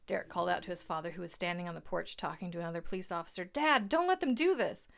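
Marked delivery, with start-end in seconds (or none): 3.4-4.9 desperate yell, drawn out. A narrator's reading. Derek yells to his dad while being arrested, desperate. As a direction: beg your father loudly. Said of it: "Derek's yelling".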